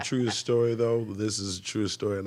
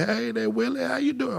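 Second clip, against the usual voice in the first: very high, singsong Southern hello; mother's Southern accent; 4 dB louder than usual. Retold or imitated. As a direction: imitate a mother slipping into Southern speech. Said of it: "make my mother's Southern accent come out".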